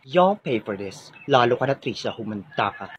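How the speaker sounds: low, slow villain vow; a hammed-up schoolgirl villain promising revenge; menacing threat. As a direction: threaten her slowly under your breath. Rival vows revenge leaving.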